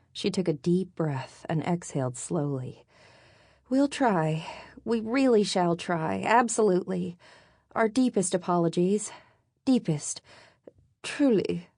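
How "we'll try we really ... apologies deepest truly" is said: higher, over-formal British apology; stilted British accent. A narrator's reading. Woman apologises to angry Brit, stilted British accent. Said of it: "didn't say that with an accent".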